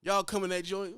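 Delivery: usual speaking voice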